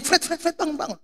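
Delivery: quick repeated high-pitched calling; imitated wake-up call. Dormitory father shaking Fred awake at dawn, high calling.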